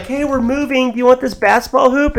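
mock neighbor. Neighbor offering a hoop on moving out, mock neighbor voice. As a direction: imitate a neighbor with a lifted voice.

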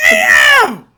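high loud "Damn!"; shocked exclamation. Real, not acted. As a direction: shout it in disbelief. Reacting to loss.

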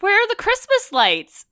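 asking like a confused partygoer; quoting people. Retold or imitated. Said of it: "multiple people were like".

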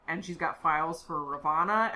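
normal talking voice

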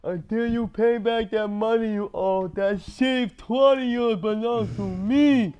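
mobster voice. Pretend. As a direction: lean on it like a loan shark. Mobster quote, far timbre.

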